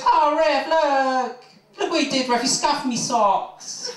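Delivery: whining diving footballer. Retold or imitated. Footballer on the ground complaining to the referee, high and whiny. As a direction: whine at the ref like a diver faking injury. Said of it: "falls down on the ground and goes".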